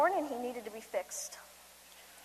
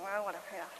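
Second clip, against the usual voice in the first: put-on bored teen; 5 semitones lower, 9 dB quieter, much faster than usual. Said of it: "He's like".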